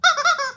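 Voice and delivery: short high burst of laughter. Real, not acted. Host laughing at Lola not moving, high burst. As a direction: laugh up high, loud and quick. Laughing at her cat on stream.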